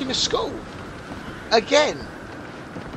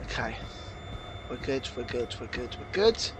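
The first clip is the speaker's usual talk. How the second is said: much lower and softer, near silent hush; 12 semitones lower, 13 dB quieter than usual. Real, not acted. Gamer hiding from monster, hushed soft reassurance. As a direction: keep it barely audible, sneaking. Sneaking quietly past danger.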